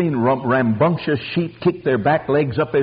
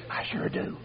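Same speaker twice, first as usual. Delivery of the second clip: whispered, confiding answer; hushed reply; 15 dB quieter than usual. Acted. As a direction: answer under your breath, as a character. Old wife answers in acted exchange.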